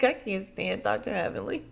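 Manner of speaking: real laugh spilling into the words; exasperated delight, laughing into it; amused outburst. Real, not acted. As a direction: crack up as you say it. Amused aside about Heavenly's comeback.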